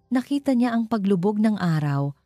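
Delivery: ordinary delivery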